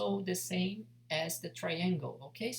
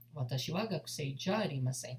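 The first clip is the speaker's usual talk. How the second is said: natural speed; 3 semitones lower, faster than usual. Shown as a demonstration.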